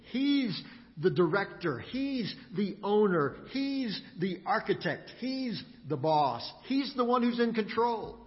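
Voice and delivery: usual speaking voice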